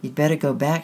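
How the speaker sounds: ordinary delivery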